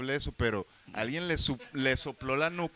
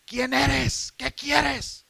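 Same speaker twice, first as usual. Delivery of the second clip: high, loud imitated shout; high-pitched retold yell, full voice; Beba Cuervo; 12 semitones higher, 8 dB louder, much slower than usual. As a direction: yell it out like a challenge to an intruder. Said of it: "Beba Cuervo grita".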